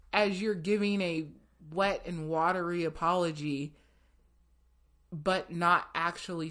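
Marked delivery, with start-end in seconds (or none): none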